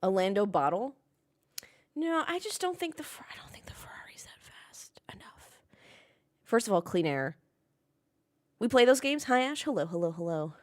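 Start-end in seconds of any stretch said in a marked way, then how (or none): 2.0-5.5 hushed conspiratorial whisper; breathy aside whisper. Acted. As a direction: whisper it like a secret. Secret take on Ferrari pace.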